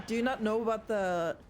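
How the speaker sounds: ordinary talk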